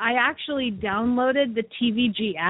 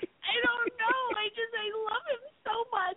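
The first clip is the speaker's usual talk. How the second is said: imitating her own sobbing; 12 semitones higher than usual. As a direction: replay the tearful moment in a broken, high voice. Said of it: "I'm like".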